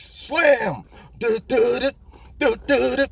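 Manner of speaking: hummed drum beat with a slam; beatbox the intro riff; vocal beat. Pretend. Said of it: "You know the song".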